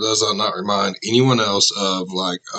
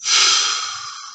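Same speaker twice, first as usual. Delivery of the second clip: shaky exhale. Real, not acted. Recovering after scary story.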